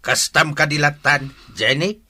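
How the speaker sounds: father scolding Jenny over her mistake; stern scolding. Acted. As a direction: confront her with rising anger. Father confronts pregnant daughter.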